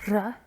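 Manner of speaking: R said with vigour and breath; vigorous breathy R. Shown as a demonstration. Teacher showing learners the Welsh R, vigorous and breathy. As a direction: push out R on a burst of breath. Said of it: "is vigorous and breathy".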